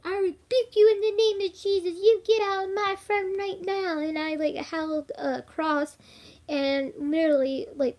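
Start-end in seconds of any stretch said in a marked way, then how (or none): 0.0-4.0 high, loud rebuke in Jesus' name; commanding rebuke. Retold or imitated. To the spirit possessing her friend, a loud high commanding rebuke. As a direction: cast the spirit out at full voice.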